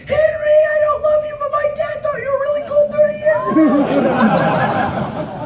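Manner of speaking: very high hammed-up shriek; gushing young fan. Running festivalgoer greeting the host, mock shrieking fan. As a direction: holler it in mock fan frenzy. Fan shouting as they run past.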